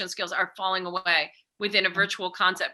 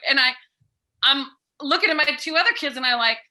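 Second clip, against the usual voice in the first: usual speaking voice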